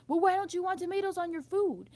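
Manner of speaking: mock the nosy person asking; imitating a pushy offended lady; mocking questioner.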